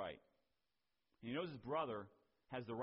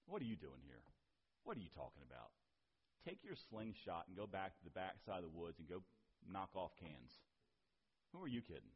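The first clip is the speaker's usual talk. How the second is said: low, soft dismissive putdown; discouraging scoff; 7 semitones lower, 6 dB quieter than usual. Retold or imitated. Doubters sending David back to his slingshot, quietly dismissive. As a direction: quietly wave the kid away, discouragingly. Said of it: "here comes discouragement".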